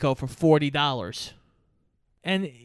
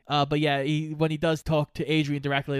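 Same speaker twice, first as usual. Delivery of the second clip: usual speaking voice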